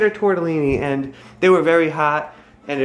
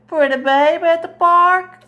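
very high, louder sing-song question; 12 semitones higher, 4 dB louder than usual. Real, not acted. Mom cooing to her daughter over a photo in high baby talk.

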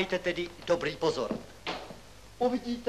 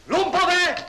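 shrieked insult, much higher and louder; furious shout; 13 semitones higher, 10 dB louder than usual. Acted. Professor to pranking pupils, furious high shout. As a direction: explode at the class.

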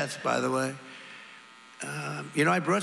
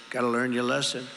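ordinary delivery

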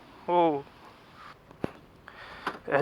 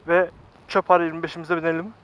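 ordinary delivery

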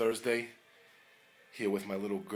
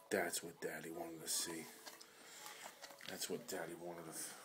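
soft whisper; 3 semitones lower, 9 dB quieter than usual. Real, not acted. Dog eats, dad whispers praise.

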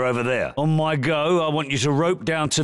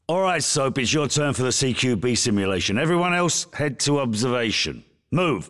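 normal talking voice

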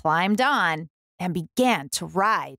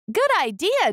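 happy animals; 11 semitones higher than usual. A narrator's reading. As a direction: cheer together on bikes. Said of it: "they all said".

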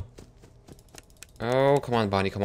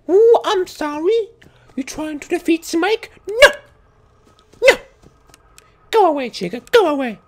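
very high mocking squeal; shrill, silly defiance; high-pitched taunting; 6 dB louder than usual. Mock taunting the attacking animatronics.

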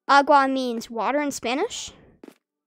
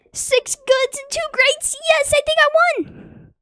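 much higher and louder, shrieking with joy; excited screaming; 13 semitones higher, 9 dB louder than usual. Real, not acted. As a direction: scream it out, thrilled to win. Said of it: "Sorry for screaming".

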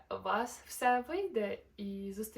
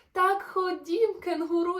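excited shout; 8 semitones higher, 11 dB louder than usual. Acted. Joyful play invitation.

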